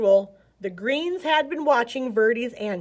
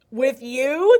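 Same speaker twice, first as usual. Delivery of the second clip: higher, louder startled question; astonished; 4 semitones higher, 8 dB louder than usual. A narrator's reading. Young snakes amazed at the old green's offer, astonished. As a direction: gasp it in surprise. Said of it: "the yellows were astonished".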